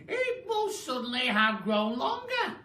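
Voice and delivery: put-on very high old woman's voice; surprised Mrs Twit. Wife surprised at her stick, high crone voice.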